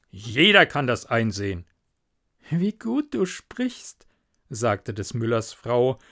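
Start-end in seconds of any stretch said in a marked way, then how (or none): 2.5-4.1 lightly voiced wife, higher and slow; slow, higher-pitched praise; admiring wife. Wife praising her husband's talk, admiring.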